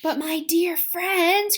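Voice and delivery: put-on old grasshopper putting on cheer; cheering up his frightened friends; cheerful old grasshopper. A narrator's reading. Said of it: "trying to be cheerful".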